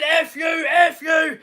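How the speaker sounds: high, slow, shouted impression of the angry caller; mock furious swearing. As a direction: ham up his F you shouts. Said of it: "I'm the dude".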